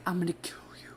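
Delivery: whispered mock threat to a child; threatening whisper. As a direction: hiss it under your breath at her. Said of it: "and I'm like".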